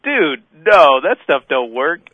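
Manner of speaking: loud, high scoffing denial; laughing off the steroid idea, loud. Retold or imitated. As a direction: shout it off as nonsense. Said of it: "I'm like".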